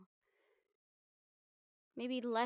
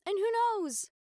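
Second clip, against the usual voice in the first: upbeat; 11 semitones higher, 5 dB louder than usual. Acted. Teasing a brighter future.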